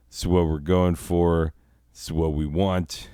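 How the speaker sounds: ordinary talk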